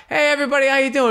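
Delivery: high, called-out mock hello to a crowd; stage greeting. Comic walking out to the Cleveland crowd, high called-out greeting. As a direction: call it out high to the whole room.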